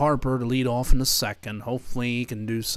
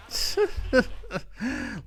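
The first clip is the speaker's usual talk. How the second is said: high-pitched amused laugh; 13 semitones higher than usual. Real, not acted. Gamer laughing at the runner's mistake, high laugh. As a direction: laugh at the blunder.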